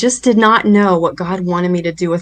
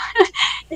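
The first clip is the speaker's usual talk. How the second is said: soft breathy laugh; 4 dB quieter than usual. Real, not acted. Laughs at the memory.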